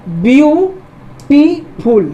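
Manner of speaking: Beautiful very slow, with long gaps: view … टी … फुल; syllable by syllable. Shown as a demonstration. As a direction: pull beautiful apart into three clear beats. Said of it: "एक syllable यहाँ पर".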